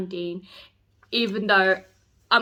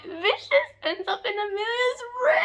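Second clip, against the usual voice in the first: squealing excitement; 12 semitones higher than usual. Real, not acted. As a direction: squeal it out like a thrilled reader. Fan squealing over plot.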